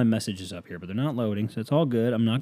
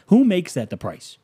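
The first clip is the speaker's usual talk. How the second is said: ordinary talk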